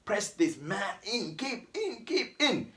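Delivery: starts soft, then climbs high on keep in; mock inner voice. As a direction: coach yourself through the squeeze in your head. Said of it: "as well as you telling your brain".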